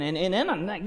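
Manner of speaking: normal talking voice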